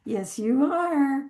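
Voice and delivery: put-on drawn-out reassurance to a baby; gentle baby-talk coo. Shown as a demonstration. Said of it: "think about how you talk to a baby".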